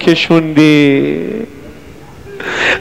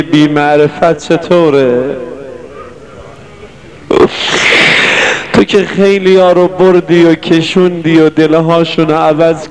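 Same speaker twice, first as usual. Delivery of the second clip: prayer. Acted. Closing supplication to the Imam.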